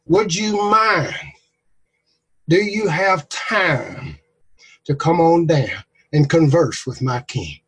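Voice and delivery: slow, carefully polite asking; timid politeness. Acted. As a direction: ask gingerly so he won't get mad. Soldier politely asks Elijah.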